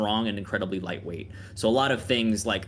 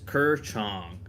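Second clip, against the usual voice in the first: creature's grunt; 3 semitones lower than usual. Acted. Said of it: "he says".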